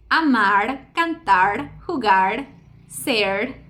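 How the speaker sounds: "amar, cantar, jugar, ser" each ending in one light tapped R; final tap R demo. Shown as a demonstration. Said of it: "en posición final como en".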